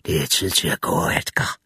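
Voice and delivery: deep, slurred whisper of the drowned thing; low rotten whisper, slurred but clear; low creature voice. A narrator's reading. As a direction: let the dead thing breathe the name low and wet. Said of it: "Det kunne også tale".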